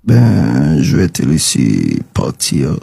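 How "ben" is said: ordinary delivery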